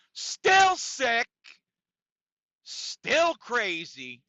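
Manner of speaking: high loud hyped-up catchphrase shout; catchphrase yell. Acted. Host hyping up his podcast crowd, high loud yell. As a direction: belt it out like a show intro.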